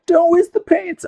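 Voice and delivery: high, slow nagging voice, clearly put on; a fussy viewer wagging a finger; mock viewer voice. Mimicking viewers who scold her about wasting paint, mock nagging. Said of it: "a lot of people are like".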